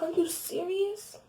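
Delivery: high, slow, incredulous; disbelieving. Retold or imitated. Asking her ex if he's serious, incredulous. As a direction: stretch it out in disbelief.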